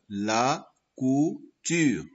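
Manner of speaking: "La couture" slowly split as la, cou, ture; syllable by syllable. Shown as a demonstration. Teacher ordering syllables for pupils, "La couture" slowly broken apart. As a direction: pause after each syllable of "La couture". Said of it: "deuxième syllabe".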